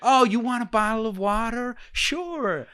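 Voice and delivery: high, bright mock server voice; cheerful barista. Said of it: "and then I'll go".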